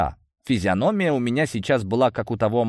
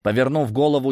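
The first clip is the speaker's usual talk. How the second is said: plain reading voice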